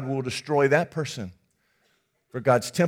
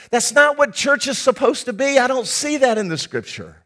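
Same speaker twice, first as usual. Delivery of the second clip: forceful preaching; 6 semitones higher, 4 dB louder than usual. Acted. Pastor rebuking go-it-alone faith.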